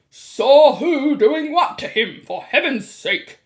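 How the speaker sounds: loud, slow exasperation; shouted. A narrator's reading. Mr. Jenkins demands to know who did what, shouting. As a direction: bellow it out in disbelief. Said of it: "shouted Mister Jenkins".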